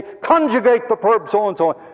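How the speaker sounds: loud, slow, high barked order; stern professor command. Retold or imitated. Strict professor calling out a student, loud slow command. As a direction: snap the order at a frozen student.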